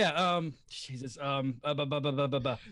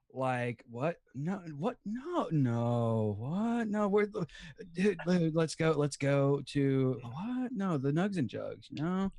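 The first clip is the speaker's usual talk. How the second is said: slow, swooping drawl; practised character voice; 4 semitones lower, slower than usual. Pretend. Player practising a voice for October, slow bewildered drawl. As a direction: drag out each bewildered question.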